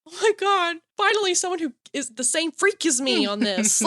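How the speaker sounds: high, excited retold reaction; thrilled outburst. Retelling finding a fellow fan, high thrilled exclamation. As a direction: exclaim as if finding a kindred spirit.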